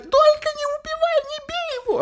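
very high panicked shriek; panicked scream. Retold or imitated. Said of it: "она начинает орать".

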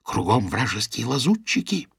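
hushed conspiratorial whisper. A narrator's reading. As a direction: drop to a secretive hush. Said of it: "произнесла она ещё тише".

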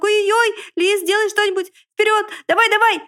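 высокий громкий панический крик; подгоняй друга в ужасе; паника. A narrator's reading. Поросёнок паникует, подгоняет лиса. (поросёнок в панике кричит лису)

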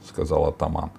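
ordinary delivery

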